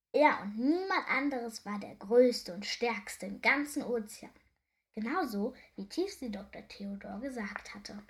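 ordinary delivery

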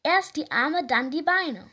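loud drill-instructor command. Acted. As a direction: bark the order at the class. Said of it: "Der Kletterlehrer kommandierte gerade".

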